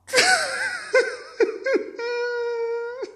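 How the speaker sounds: long high-pitched wail; hammed-up sobbing squeal; mock crying. Father weeping over the ruined family, hammed-up high wail. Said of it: "And he kept crying".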